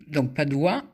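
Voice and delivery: ordinary talk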